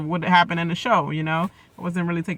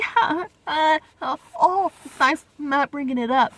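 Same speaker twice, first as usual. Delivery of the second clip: high-pitched mock-friendly imitation; 8 semitones higher than usual. Reactor mimicking a contestant being all friendly, mock-sweet and high. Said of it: "she was all friendly".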